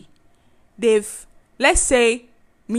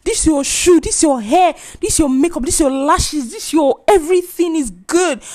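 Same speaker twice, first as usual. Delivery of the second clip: loud, higher-pitched hyping up; acted shouting; 4 semitones higher, 5 dB louder, slower than usual. Loud person seeing a friend in real life, shouting compliments. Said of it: "I'm shouting like".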